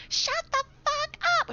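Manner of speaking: very high screechy mock scream; imitated scream. Bullied girl finally snapping at her tormentor, mock screaming. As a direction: mimic a girl screaming at you, drawn out and high. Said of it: "she was screaming at me".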